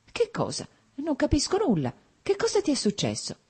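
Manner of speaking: high-pitched, bewildered questions; alarmed woman, thin on volume but high; alarmed bewilderment. A narrator's reading. Frightened bride questions her fiancé.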